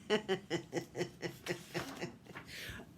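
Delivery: real chuckling laugh; amused, repeated giggle. Real, not acted. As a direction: chuckle in a long run at yourself. Laughs at own mistake.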